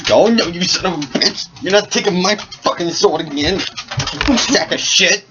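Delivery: high, hammed-up furious rant; a comic fit of rage with a character voice; enraged yelling. Harvey screaming at the old thief while beating him, high and hammed-up.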